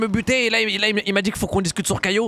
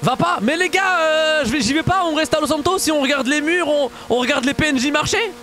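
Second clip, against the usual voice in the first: excited; 7 semitones higher than usual. Real, not acted. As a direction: burst out to the viewers. Excited aside to viewers.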